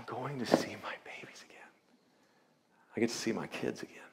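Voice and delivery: barely voiced, holding back tears; mother whispering she'll see her kids again; hushed whisper. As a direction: whisper it, overcome with emotion. Patient overwhelmed, about seeing kids.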